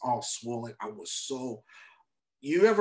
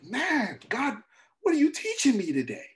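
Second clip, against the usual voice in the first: exasperated; 11 semitones higher, 3 dB louder than usual. Retold or imitated. Exasperated plea to God.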